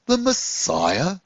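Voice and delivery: stunned by the news; gasped. Acted. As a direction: scarecrow astonished at a secret. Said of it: "Jaffa gasped".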